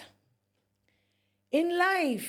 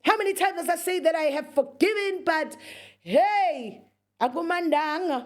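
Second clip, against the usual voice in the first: loud, higher, fast fed-up rant; exasperated outburst; 7 semitones higher, 5 dB louder than usual. Retold or imitated. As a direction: blow up at the cousin, half-shouting. Angry retort to cousin on phone.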